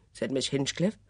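ordinary delivery

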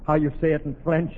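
normal talking voice

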